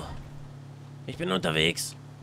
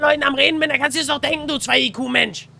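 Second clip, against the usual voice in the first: genervt; 6 semitones higher, 5 dB louder than usual. Real, not acted. Genervt zurechtgewiesener Freund.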